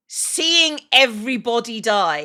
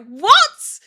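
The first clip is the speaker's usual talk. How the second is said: sudden high, loud disbelieving yelp; shocked what; 10 semitones higher, 9 dB louder than usual. Retold or imitated. Retelling Doc's news, a stunned loud high what. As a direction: blurt it out, much higher and louder, stunned. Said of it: "And I'm like".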